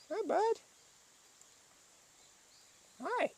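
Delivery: very high, soft pet greeting. Real, not acted. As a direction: squeak a hello up high to the kitty. Greeting the cat.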